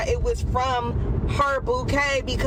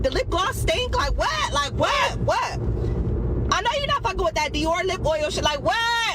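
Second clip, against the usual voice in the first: much higher, indignant repeated whats; high mock bewilderment; high confused ranting; 9 semitones higher than usual. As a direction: drunk and baffled, pitched way up. Drunk confused ranting quote.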